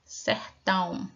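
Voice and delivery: Sertão said slowly and deliberately; slow clear reading. Shown as a demonstration. Teacher modelling Sertão to pupils, slow and clear. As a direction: draw Sertão out for the class. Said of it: "a palavrinha sertão".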